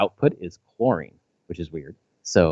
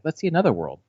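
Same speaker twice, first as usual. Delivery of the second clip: higher-pitched imitation of an eager child; super excited kid; 10 semitones higher than usual. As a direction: lightly act out a daughter's excitement. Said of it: "She was super excited".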